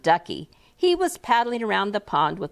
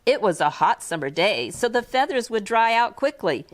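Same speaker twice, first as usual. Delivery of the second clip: plain reading voice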